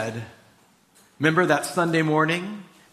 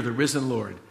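ordinary delivery

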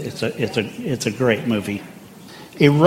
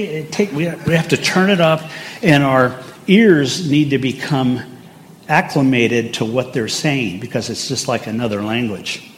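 ordinary delivery

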